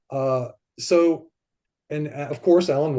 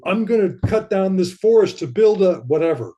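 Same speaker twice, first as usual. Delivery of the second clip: louder, higher, forceful mock resolve; tightened up; 5 semitones higher, 5 dB louder than usual. Speaking as someone out to dominate nature, tightened up. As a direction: push it out tense and raised, imitating a controller. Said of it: "not to tighten up and go".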